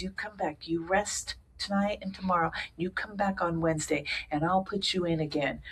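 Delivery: soft, kind doctor's voice; gentle doctor. Retold or imitated. Kind heart doctor telling the patient to rest, gently. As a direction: reassure her quietly. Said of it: "He goes".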